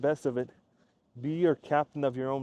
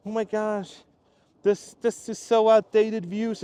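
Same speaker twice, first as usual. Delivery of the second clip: higher, louder, slow, scandalized; mocking Reddit critic; 6 semitones higher, 5 dB louder than usual. Mocking Reddit critics of his views, higher and scandalized. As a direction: send up the pearl-clutching commenters.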